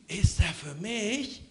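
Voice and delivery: soft, slow, stunned disbelief; hardly daring to believe it. Acted. Lubo frozen, staring at the red ball, softly amazed. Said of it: "steht da ganz erstarrt".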